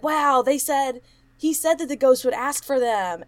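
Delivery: excited quote. Retold or imitated. Retelling the investigators amazed at the ghost, excited. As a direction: imitate the investigators gasping with excitement.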